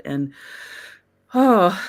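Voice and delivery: ordinary talk, nothing marked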